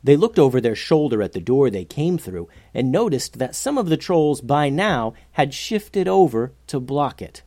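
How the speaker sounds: ordinary delivery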